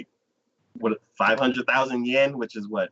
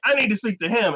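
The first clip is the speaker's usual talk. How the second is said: higher-pitched mock demand; insistent demand; 9 semitones higher, faster than usual. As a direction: imitate a woman demanding access. Woman demands access from receptionist.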